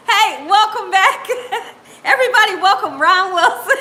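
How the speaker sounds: laughing through a greeting; real laughter breaking into a warm greeting; laughing welcome. Real, not acted. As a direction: welcome him while you can't stop laughing. Laughing while welcoming Ron Wilson.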